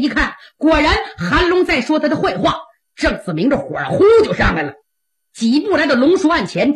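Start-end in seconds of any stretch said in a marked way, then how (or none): none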